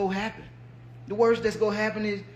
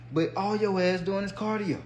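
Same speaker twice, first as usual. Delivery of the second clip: ordinary delivery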